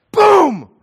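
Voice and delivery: loud, high mock smack noise; mock smack boom. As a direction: shout out the sound of the slap. Husband smacks the child.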